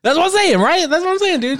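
excited agreement. Real, not acted. Friend insisting in agreement over acid attacks, excited. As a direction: pitch it up and insist.

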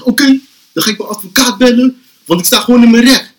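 high-pitched, mock self-righteous arguing; indignant protest. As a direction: imitate the friend insisting on his rights. Acts friend arguing with police. (gespeeld verontwaardigd)

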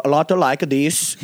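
sing-song Nordic accent, a touch high. Shown as a demonstration. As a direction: demo a silly Nordic accent, drawn out. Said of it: "like, Nordic accent".

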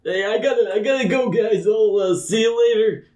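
high, nervous excuse; high panicky NPC. Acted. Chosen man excuses himself from friends, high and panicky. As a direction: pitch it up and flee.

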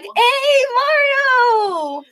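high, loud "Hey Mario" cry. Retold or imitated. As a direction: call it out high and loud like a fan.

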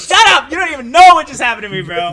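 high, loud annoyed shout; shouting at a teasing classmate. Retold or imitated. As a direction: yell shut up at a friend. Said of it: "I'm like".

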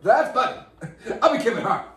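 high-pitched comedian's yell; Chris Rock. Acted. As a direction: burst out loud and high like a stand-up. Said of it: "sounded like Chris Rock a little bit".

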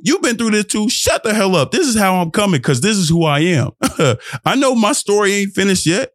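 usual speaking voice

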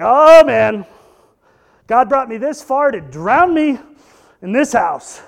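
loud, hammed-up despair over a leaking roof; mock panicked outcry. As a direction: wail it like the roof is caving in. Retold panic over roof leak.

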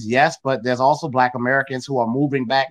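ordinary talk

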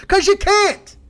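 high, loud furious outburst; yelling at people who want to say the word; angry shout. Real, not acted. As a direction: blow up at them, full force.

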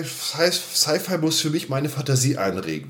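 usual speaking voice

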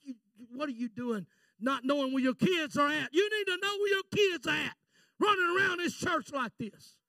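high, forceful imitation of a rebuke; retold scolding. Pastor rebuking a father about his kids, retold scolding. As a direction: lay into him as the angry pastor. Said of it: "he just rolled into me".

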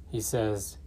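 ordinary talk, nothing marked

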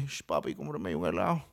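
low, slow, soft Colombian coaxing; mock Colombian accent. Colombian woman asking for ice cream, low soft accent. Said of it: "una colombiana me dice".

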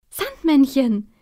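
slow, loud, relieved cry; a boy calls out in relief as the Sandman appears, loud and slow. Acted. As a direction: call out his name in sheer relief. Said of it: "ruft Tim erleichtert".

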